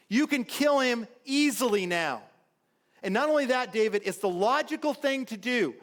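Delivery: high, insistent pitch to a leader; urgent persuasion. Acted. David's men press him to kill Saul, urgent persuasion.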